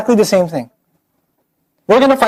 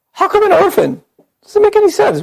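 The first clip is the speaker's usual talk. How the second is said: high, scoffing sneer at an orphan prophet; high mocking voice of the Meccan doubters; mocking disbelief; 10 semitones higher than usual. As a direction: scoff like a smug critic. Mocking the unbelievers' objection.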